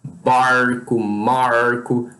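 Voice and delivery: put-on "barco, marco" in a Portuguese accent with curled R; slow curled-R Portuguese accent on "barco, marco"; Portuguese retroflex-R accent. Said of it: "Como quem tem o sotaque".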